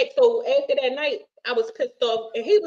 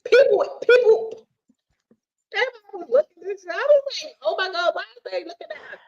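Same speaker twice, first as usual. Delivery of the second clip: high embarrassed exclaim; 9 semitones higher than usual. Retold or imitated. Embarrassed in public.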